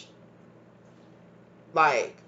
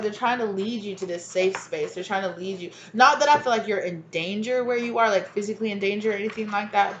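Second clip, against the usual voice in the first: normal talking voice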